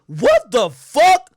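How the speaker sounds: high, loud, slow "what the fuck"; stunned yell on waking to a dog; shocked outburst. Retold or imitated. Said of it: "and I'm like".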